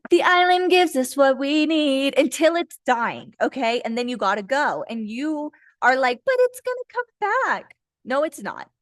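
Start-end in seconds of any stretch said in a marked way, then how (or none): 0.1-3.3 higher, put-on parroting voice; mocking imitation. Mimicking those refusing to leave. 6.3-7.7 high, whiny put-on protest; mock pleading denial. Voicing listener in denial.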